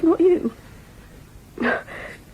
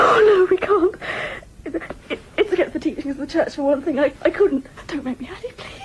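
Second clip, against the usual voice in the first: crying out, then breaking down to a broken plea; begging through tears, fading weaker; tearful pleading; 7 semitones higher than usual. Acted. Tearful plea to her uncle not to make her go through with it.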